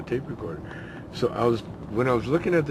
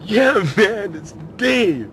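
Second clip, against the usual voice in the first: much higher, louder mellow stoner; stoner at the door; 11 semitones higher, 9 dB louder, much slower than usual. Retold or imitated. Dave outside to his friend, high laid-back stoner. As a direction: lay back, just a buddy.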